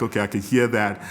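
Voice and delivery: normal talking voice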